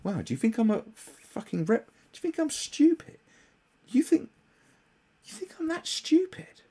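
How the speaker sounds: high, disbelieving sarcasm that drops to a whisper; incredulous mock outrage. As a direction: play your own affronted disbelief. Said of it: "You're like".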